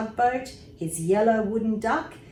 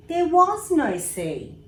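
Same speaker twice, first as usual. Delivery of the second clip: surprised; 10 semitones higher, 6 dB louder than usual. A narrator's reading. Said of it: "he said".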